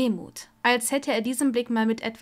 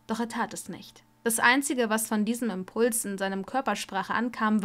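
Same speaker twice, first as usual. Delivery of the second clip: ordinary delivery, nothing marked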